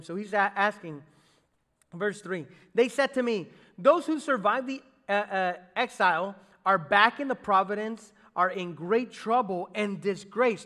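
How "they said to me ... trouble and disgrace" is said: dramatic scripture reading. Acted. Pastor reading the grim report to the congregation, loud dramatic reading. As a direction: proclaim the trouble to the room. Reads scripture, rising in force.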